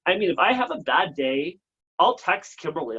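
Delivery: normal talking voice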